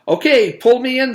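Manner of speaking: high, loud urgent order; boy dangling, calling urgently, high and loud. Acted. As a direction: call up for help. Paul urgent.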